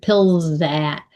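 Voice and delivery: "pills that" with no gap, long buzzing z; one word. Shown as a demonstration. Coach repeating the linked form for learners, one word. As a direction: draw out the z joining "pills that". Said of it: "One word".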